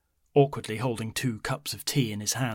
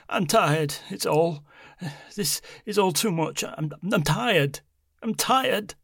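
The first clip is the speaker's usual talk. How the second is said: put-on distressed old woman voice; tired, on the edge of tears; breaking voice; 7 semitones higher, 3 dB louder, slower than usual. A narrator's reading. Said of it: "her breaking voice".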